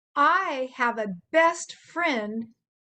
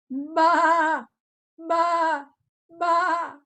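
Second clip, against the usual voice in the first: mild bleating baa, a bit higher; sheep baa; 5 semitones higher, 4 dB louder, much slower than usual. Shown as a demonstration. Showing the kids how a sheep goes baa. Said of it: "A sheep could go".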